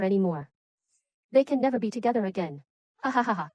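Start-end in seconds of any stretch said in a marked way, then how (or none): 3.0-3.5 a short, satisfied villain's laugh; gloating laugh. Acted. Woman gloating that the lovers can never reunite, gloating laugh. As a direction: laugh at their ruin, pleased with yourself.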